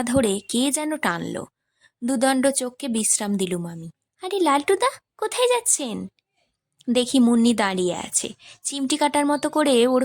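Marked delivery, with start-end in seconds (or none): 4.2-6.1 put-on high, light young girl calling out; girl's voice. A narrator's reading. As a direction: call out like a cheeky young girl. Munni's voice in story.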